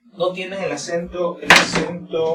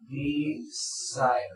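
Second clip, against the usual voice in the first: desire slowly split, de-SIRE, softer; second-syllable stress; 3 semitones lower, 7 dB quieter than usual. Shown as a demonstration. Teacher models the word in parts, stress on SIRE in desire. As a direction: stretch desire with weight on SIRE, falling away. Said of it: "la mayor fuerza de voz en esta segunda línea".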